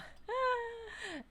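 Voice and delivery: high, falling laugh. Real, not acted. Player reacting to the game, high falling laugh. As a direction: laugh high and let it trail off.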